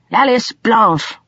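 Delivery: high, slow, emphatic declaration of a name; emphatic naming. A narrator's reading. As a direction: declare the name with agitated weight. Agitated man insists on identity.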